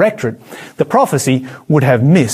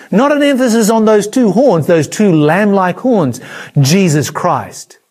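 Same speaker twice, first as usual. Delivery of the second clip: ordinary talk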